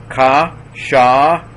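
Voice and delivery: ka, sha, each held out high and loud; teacher's slow, loud breakdown of ka, sha; syllable by syllable. Shown as a demonstration. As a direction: sound out ka, sha as separate, ringing syllables. Teacher sounds word out by syllables.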